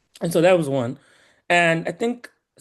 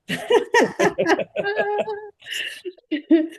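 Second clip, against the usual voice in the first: big laugh. Real, not acted. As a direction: let a full laugh ring out. Laughs at her own joke.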